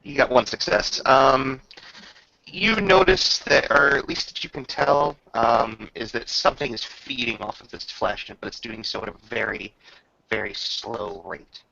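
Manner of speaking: ordinary talk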